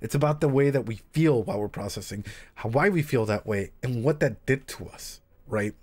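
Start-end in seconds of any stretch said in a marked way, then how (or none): none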